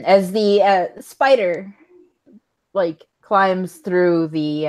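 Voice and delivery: ordinary talk, nothing marked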